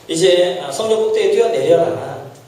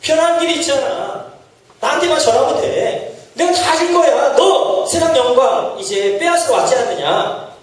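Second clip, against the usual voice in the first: higher, laughing offer; a touch of the devil's sly laugh; tempting devil; 5 semitones higher than usual. Acted. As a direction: dangle the world in front of him. Preacher voices Satan's offer.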